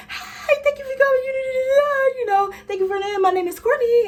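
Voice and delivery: high, gushing call-center voice; hammed-up excited customer greeting; peppy phone greeting. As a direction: chirp it like an overeager phone rep. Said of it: "I would be on the phone like".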